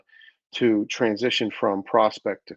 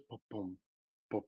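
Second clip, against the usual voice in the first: thumps dropping away softer; imitated heartbeat; 3 semitones lower, 17 dB quieter than usual. Shown as a demonstration. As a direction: sound out heartbeats, letting them fade. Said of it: "that noise, that sound".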